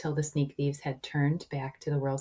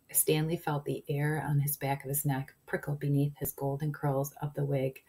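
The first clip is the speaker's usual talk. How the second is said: plain reading voice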